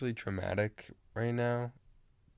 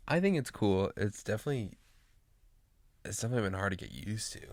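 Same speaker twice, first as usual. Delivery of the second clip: ordinary delivery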